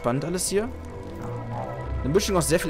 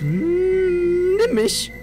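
very high, loud teasing voice; seductive falsetto; 6 dB louder, much slower than usual. Acted. Hams up a seductive line.

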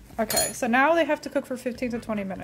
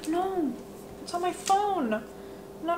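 dismayed; 6 semitones higher, 6 dB quieter, slower than usual. Real, not acted. Juice spilled on her phone.

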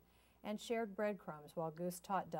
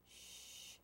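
soft, breath-only shush; hushing shh; 16 dB quieter than usual. A narrator's reading. Goose hushes Duck over the egg, shushing. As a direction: shush gently. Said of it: "Goose hushed".

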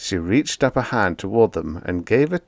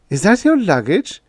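high, louder fussy landlady; nosy landlady voice; landlady asking; 11 semitones higher, 5 dB louder than usual. A narrator's reading. Landlady asks her tenant about his box, high and fussy.

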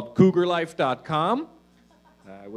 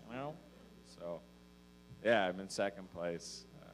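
usual speaking voice

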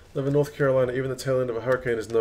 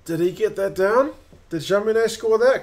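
excited; 9 semitones higher, 5 dB louder than usual. Real, not acted. Possible try, fantasy player.

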